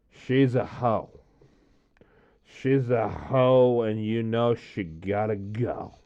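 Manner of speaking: put-on drunken slow sing-song; slurring it out drunk and slow; a tipsy friend's mock verdict. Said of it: "That's how I was. I was really drunk".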